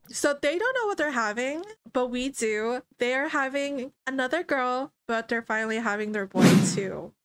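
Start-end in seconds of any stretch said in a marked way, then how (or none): none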